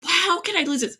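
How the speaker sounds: mock despair at losing it; remembered panic over a lost poem; dismayed disbelief. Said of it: "and I was like".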